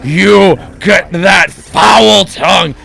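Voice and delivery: loud, slow scolding shout; angry shout. Acted. Teacher orders the boy out of class, shouting. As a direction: throw him out of the room. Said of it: "Miss Withers exclaims".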